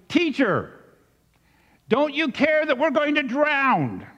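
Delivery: high-pitched desperate cry to the teacher; disciples shouting. A narrator's reading. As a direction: call out as if drowning in the storm.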